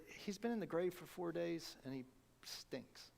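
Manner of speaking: dropping to a whisper on stinks; hushed aside. Retold or imitated. Martha warning Jesus about the body, hushed whisper. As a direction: lean in and whisper the gross part.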